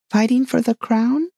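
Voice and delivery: high rising question; surprised question. A narrator's reading. Alice questions the king in surprise.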